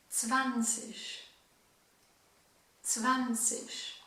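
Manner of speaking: "Zwanzig" said with a falling ts-start, "-zig" like "sich"; German drill. Shown as a demonstration. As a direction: repeat "Zwanzig" slowly for learners. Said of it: "je vais te donner tous les chiffres". (répéter « Zwanzig » lentement, ton descendant)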